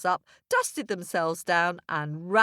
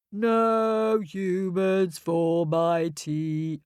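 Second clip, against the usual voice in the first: sad moan; 3 dB louder, slower than usual. Acted. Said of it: "moaned sadly to himself".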